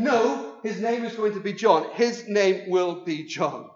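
loud, firm, slowing on the last line; emphatic Zechariah. Acted. Zechariah insisting to the crowd, loud and emphatic. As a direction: write it in capitals with your voice. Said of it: "he wrote emphatically".

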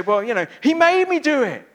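put-on high, loud whiny child making excuses; childish excuse. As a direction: whine it like a kid caught out. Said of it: "like our children say sometimes".

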